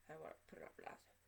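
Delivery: quick whisper; fast voiceless aside. Real, not acted. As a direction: rush it out under the breath. Keeping it private.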